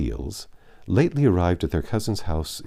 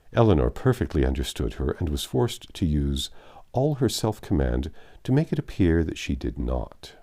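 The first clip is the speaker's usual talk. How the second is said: plain reading voice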